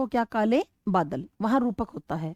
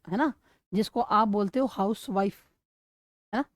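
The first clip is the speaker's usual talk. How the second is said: usual speaking voice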